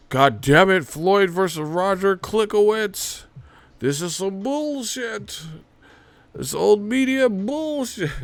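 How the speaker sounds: high, slow indignant rant; hammed-up outrage in a put-on voice; mock angry critic. Old media critic ranting about Floyd's opponent, high mock outrage. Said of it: "They would be angry as fuck".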